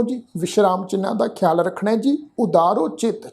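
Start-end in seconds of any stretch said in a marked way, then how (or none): none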